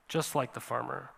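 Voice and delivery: soft echo. Acted. Preacher echoing his own point to the congregation, hushed. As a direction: let it fall away to a near hush. Preacher echoes phrase softly.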